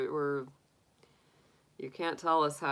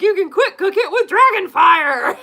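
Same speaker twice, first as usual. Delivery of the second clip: mock dragon; 14 dB louder than usual. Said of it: "he's like".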